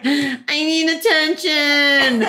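drawn-out, high-pitched whine; exaggerated needy whine, very slow; whining. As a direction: imitate a grown man whining for attention. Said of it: "how I feel about Kai's whining".

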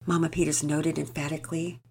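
ordinary delivery, nothing marked

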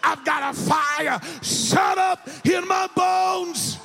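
high, slow shouted preaching; rousing the congregation to a shouted climax; preaching at full force. Acted. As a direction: drive it home to the church.

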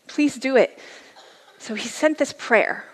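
usual speaking voice

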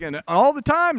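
high, loud churchy call-out; imitating an enthusiastic woman leading a church call-and-response; excited church lady. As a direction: belt it out like an eager lady at testimony time. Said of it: "she's like".

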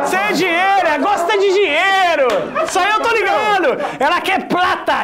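gleeful mock outrage; teasing heckle. Teasing heckle about money.

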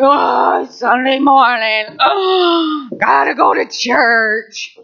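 slow, whining groan about church; begrudging whine. Pretend. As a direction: moan like dragging yourself out of bed.

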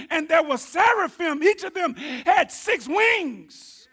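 fervent pulpit preaching; preaching at full force. Real, not acted. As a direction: preach it out to the back pew.

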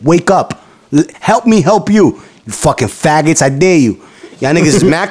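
ordinary delivery

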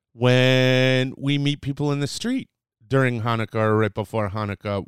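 normal talking voice

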